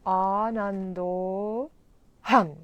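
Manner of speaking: ANANDO slowly stretched, then a loud aspirated HAM; slow "Anando", forceful breathy H on "Ham"; long O. Shown as a demonstration. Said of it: "it's always long".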